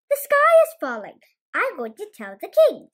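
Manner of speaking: high, loud panic; alarmed cry. Acted. Chicken Little telling Henny Penny the news, frantic and loud. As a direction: blurt it out frantic.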